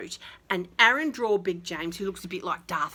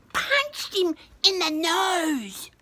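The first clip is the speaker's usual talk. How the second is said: high, slow and emphatic; triumphant punchline; 9 semitones higher, 3 dB louder, much slower than usual. A narrator's reading. Storyteller to kids, hero punches bear, high slow triumph. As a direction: land the punch with relish.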